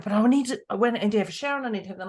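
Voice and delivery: fast, flustered rush of worries; rushed fretting partner. Retold or imitated. Partner fretting to Deborah about needing a shower, rushed. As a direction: gabble it like a stressed partner. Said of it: "And she's like".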